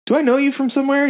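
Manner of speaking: high, fast eager question; mock eager fan. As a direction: blurt it like an excited fan. Fan recognizing him.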